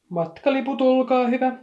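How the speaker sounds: high, loud and formal ticket call; official conductor voice. A narrator's reading. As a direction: announce it like an important official. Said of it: "niin virallisella ja selkeällä äänellä".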